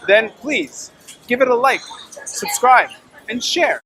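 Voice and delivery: upbeat sign-off pitch; podcast outro. Acted. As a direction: sell the channel to viewers.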